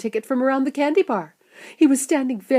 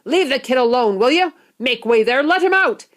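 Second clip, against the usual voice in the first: loud, higher, protective shopkeeper; commanding shout; 4 semitones higher, 6 dB louder than usual. A narrator's reading. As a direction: order the crowd aside forcefully. Shopkeeper orders crowd aside.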